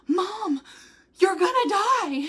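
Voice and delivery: high-pitched imitation of a tearful child; Logan almost crying. Mother imitating her son fearing her fast, almost crying. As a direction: plead with Mom in mock near-tears. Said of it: "literally almost started crying".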